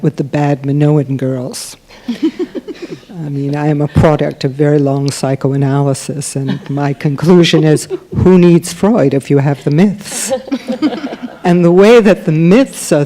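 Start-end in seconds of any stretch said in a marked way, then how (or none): 2.1-3.1 light laugh. Real, not acted. Laughing about bad Minoan girls. 10.4-11.4 light laugh. Real, not acted. Laughing at own joke.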